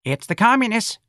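put-on high-pitched old man cutting in; interrupting old man. A narrator's reading. Old man interrupting about communists, high-pitched outburst. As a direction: break in with a high old man's voice. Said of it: "the old man interrupted".

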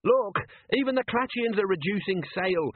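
high-pitched urgent call; panicky shout. A narrator's reading. Captain pointing out the other ship, high shout. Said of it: "shouted Jenkins".

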